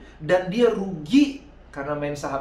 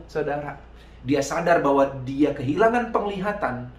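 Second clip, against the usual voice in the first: normal talking voice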